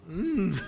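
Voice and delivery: breathy cackle as the spirit enters; spooky possession laugh. Acted. Spirit arrives in the body.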